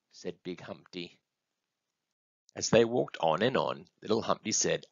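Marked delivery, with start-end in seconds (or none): none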